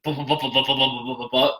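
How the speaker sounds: bumping down stairs sounds. Retold or imitated. Imitates bumping down basement steps.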